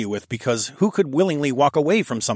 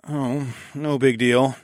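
deadpan; 3 semitones higher, slower than usual. A narrator's reading. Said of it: "I deadpanned".

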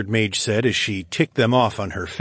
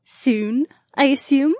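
higher, playful teasing tone; lightly teasing young woman; slower than usual. Acted. Voiced Julia teasing.

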